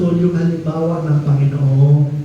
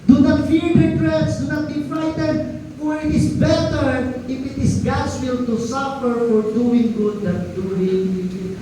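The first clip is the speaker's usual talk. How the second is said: raised, slow scripture reading; 7 semitones higher, slower than usual. Acted. Pastor reads scripture to congregation, raised and slow. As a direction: read the verse out to the church, slowly.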